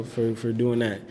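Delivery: ordinary talk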